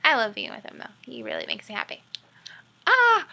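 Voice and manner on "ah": high, loud giddy squeal; excited squeal. Real, not acted. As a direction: squeal with delight. Gushing over her boyfriend.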